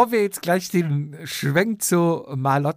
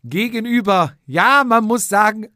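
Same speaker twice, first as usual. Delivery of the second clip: normal talking voice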